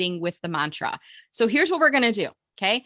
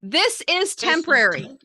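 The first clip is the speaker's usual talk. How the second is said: high, slow, emphatic mantra; rousing call for the group to echo; enthusiastic; 6 semitones higher, 3 dB louder, slower than usual. Shown as a demonstration. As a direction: lead the chant with real conviction. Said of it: "now with enthusiasm".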